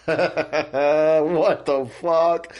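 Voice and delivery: slow, drawn-out shocked reaction; shocked what the fuck. Retold or imitated. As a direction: stretch it out in disbelief. Three people react shocked.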